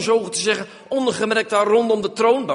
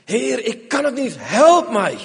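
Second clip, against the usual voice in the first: cry for help; 3 semitones higher, 4 dB louder, slower than usual. Acted. Prayer for help.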